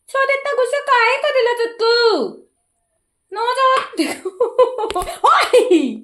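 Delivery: high, slow, half-laughing coax; calm the cranky bird in a high voice; pet talk. Real, not acted. Soothing the angry parrot.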